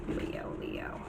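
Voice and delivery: whispered, barely voiced; whispering to herself. Real, not acted. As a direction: mutter it under your breath. Muttering while searching.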